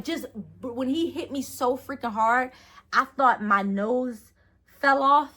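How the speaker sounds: ordinary talk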